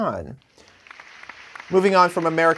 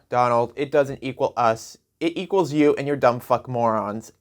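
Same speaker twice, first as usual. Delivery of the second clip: ordinary delivery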